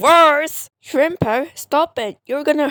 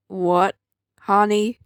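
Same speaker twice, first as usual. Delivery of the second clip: normal talking voice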